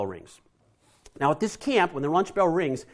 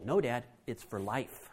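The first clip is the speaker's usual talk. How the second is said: put-on calm child; 4 semitones lower, 7 dB quieter than usual. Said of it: "And I'm like".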